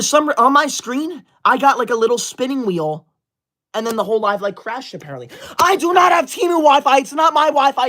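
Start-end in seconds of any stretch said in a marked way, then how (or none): 5.6-7.9 indignant outburst. Real, not acted. Annoyed streamer snaps at chat.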